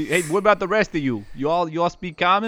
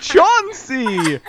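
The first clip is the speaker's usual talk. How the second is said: high, loud drawn-out call; 10 semitones higher, 5 dB louder than usual. Acted. Game master as Romeo calls out a name, loudly. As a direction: sing out the name at full voice.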